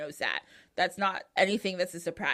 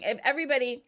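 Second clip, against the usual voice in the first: ordinary talk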